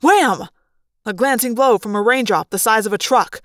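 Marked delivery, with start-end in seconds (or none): none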